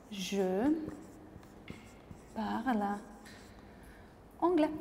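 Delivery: each word set apart with long pauses; teacher dictating slowly. Shown as a demonstration. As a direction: lay out "Je parle anglais" word by word for the board. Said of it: "j'utilise le verbe".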